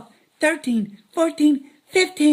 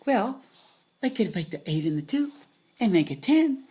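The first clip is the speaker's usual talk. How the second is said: ordinary talk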